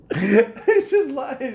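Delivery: laughing hard. Real, not acted. Cracking up at the sketch.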